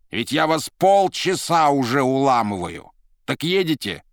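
громко и повышенным тоном, теряя терпение; громкая досада уставшего уговаривать посланца; раздражённые уговоры. Acted. Азазелло раздражённо уламывает Маргариту. (раздражённо, с досадой)

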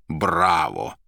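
a restrained foreigner's "bravo" called out; exclaiming. A narrator's reading. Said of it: "вскричал иностранец". (одобрительный возглас)

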